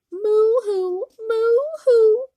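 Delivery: loud high sobbing moo-hoo; crying moo. Acted. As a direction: wail the moo like a heartbroken cow. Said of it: "and she began to cry".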